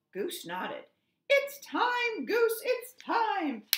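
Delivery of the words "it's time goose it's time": high, loud, slow squawk. A narrator's reading. Duck squawks to Goose that it's time. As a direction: squawk the news in a panic. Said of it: "Duck squawked".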